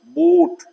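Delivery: बूट with a long ऊ, called out high and loud; long oo demo. Shown as a demonstration. Teacher calls out बूट to students, holding the long ऊ. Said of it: "long ऊ".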